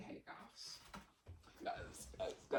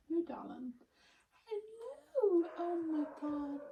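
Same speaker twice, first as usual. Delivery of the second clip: much higher cooing hello to a pet; 9 semitones higher than usual. Real, not acted. Cooing at her cat.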